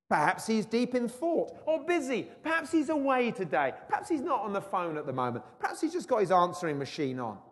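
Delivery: high, loud sarcastic jeering; provoking taunt. Acted. Elijah jeering at the prophets, loud high sarcasm. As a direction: needle them about their silent god. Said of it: "he was being provoking".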